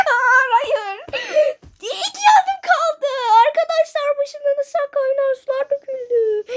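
very high, laughing shrieks; hysterical laughing. Real, not acted. High hysterical laugh-cry. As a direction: gamer laughing hysterically after a near fall.

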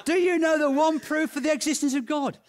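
loud, high-pitched retold question; emphatic restart.

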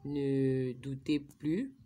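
normal talking voice

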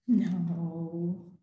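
goat voice. Acted. Goat answers, low far voice.

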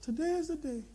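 high, soft put-on trainer voice; trainer's voice. Trainer solemnly telling the pastor today is the day, high and soft. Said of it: "Milton then says to me".

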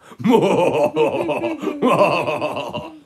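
high, loud hammed-up evil giggle. Wolf giggling on the roof, evil giggle. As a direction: cackle like a sneaky wolf. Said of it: "had a little evil giggle".

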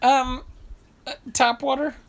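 Larry David mocking the tap water. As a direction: needle him in a high incredulous voice. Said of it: "He goes".

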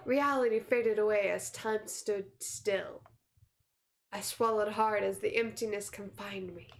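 slow, low-key suspense narration; measured, subdued storyteller; story narration. A narrator's reading. Reads game's narration.

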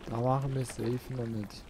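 ordinary talk, nothing marked

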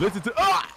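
pained cry. Acted. Hostage cut off mid-plea.

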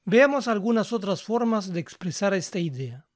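usual speaking voice

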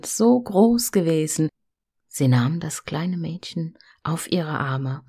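plain reading voice

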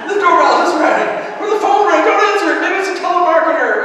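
loud, high-pitched comic alarm; mock panicked shout. Household warns each other about a telemarketer, mock panicked shouting. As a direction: yell it in exaggerated panic. Acts panic at doorbell and phone.

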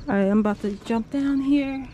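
usual speaking voice

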